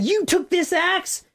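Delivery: high, drawn-out mock courtroom accusation; menacing accusation. Lawyer running at defendant with the axe, menacing. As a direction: act out a lawyer lunging in to accuse. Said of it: "almost like he's gonna hit Candy".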